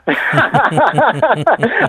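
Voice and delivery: long, high-pitched belly laugh; hearty laugh. Real, not acted. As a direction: burst out laughing at your own joke. Se ríe de su propio chiste. (carcajada larga y aguda)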